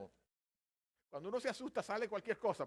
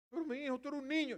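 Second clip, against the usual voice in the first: high, loud objection; incredulous protest; 11 semitones higher, 7 dB louder than usual. Acted. Saúl doubting young David can fight, incredulous protest. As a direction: protest as if to a child.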